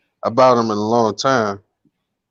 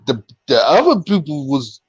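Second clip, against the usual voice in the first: ordinary talk, nothing marked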